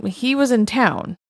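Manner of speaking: usual speaking voice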